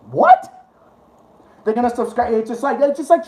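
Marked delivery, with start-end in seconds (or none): 0.1-0.6 loud, high-pitched incredulous yelp; sudden high jump of bafflement. Real, not acted. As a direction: squeak it out in disbelief. Incredulous at hate subscribers.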